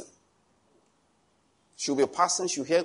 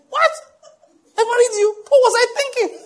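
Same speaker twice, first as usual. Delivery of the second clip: squeaky, much higher, louder mock shock; mock disbelief; 8 dB louder than usual. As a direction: ham it up like you can't believe yourself. Husband mocking his own wedding choice.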